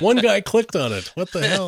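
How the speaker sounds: high, loud comic indignation; mock outrage. Marketer baffled by one lone click, mock outraged. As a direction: exclaim it in hammed-up disbelief, way up high.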